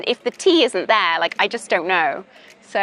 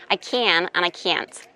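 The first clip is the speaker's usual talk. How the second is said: imitated American accent, same vowel in "can" and "can't". Shown as a demonstration. Teacher contrasting can and can't for a student, American accent. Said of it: "in American".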